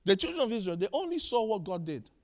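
ordinary delivery

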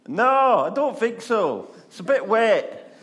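high, loud comic refusal; politely backing out, hammed-up; reluctant Peter. Peter refuses to leave the boat, loud and comically reluctant. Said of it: "Peter refuses, comic".

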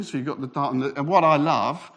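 usual speaking voice

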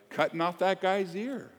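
loud, high, driving sermon delivery; full-force preaching. Acted. Pastor rousing the congregation, preaching hard and loud. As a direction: preach it out to the whole room.

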